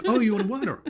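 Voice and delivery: mock dawning recognition; sudden realisation. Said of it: "And she was".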